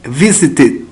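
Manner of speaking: VISITED in three syllables, ending TID. Shown as a demonstration. Teacher models past of visit, VISITED with ID ending. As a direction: stress VIS, finish VISITED on short ID.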